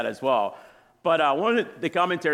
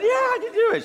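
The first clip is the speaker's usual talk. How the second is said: very high, louder retort; playfully overconfident, pitched way up; overconfident reply; 5 dB louder than usual. Retold or imitated. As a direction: insist brightly that you can manage. Cocky reply to doubting wife.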